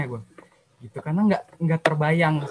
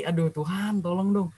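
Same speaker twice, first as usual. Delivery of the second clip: soft mock plea under the breath; quietly beg, as a retold thought; inner plea; 6 dB quieter than usual. Praying silently while friends get accepted, softly pleading. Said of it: "Dalam hati".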